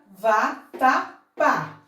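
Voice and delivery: "vatapá" very slowly, split VA, TA, PÁ; syllable by syllable. Shown as a demonstration. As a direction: pause between each syllable of "vatapá". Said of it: "temos a sílaba tônica justamente aqui".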